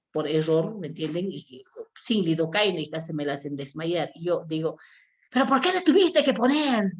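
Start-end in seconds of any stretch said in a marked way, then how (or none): 5.3-6.9 sharp high shout at the nurse, loud and accusing; retold angry yell. Doctor scolding a nurse over a painful injection, angry shout. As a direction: bark the question at her like a furious doctor. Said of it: "¿Por qué estoy gritando?".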